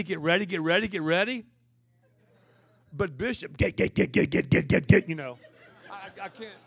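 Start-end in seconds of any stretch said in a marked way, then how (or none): 0.0-5.4 louder, rhythmic preacher build; impression of Bishop Jakes. Preacher mimicking Bishop Jakes to the crowd, punchy imitation. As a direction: hammer the words like a revving preacher. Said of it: "that's Bishop Jakes".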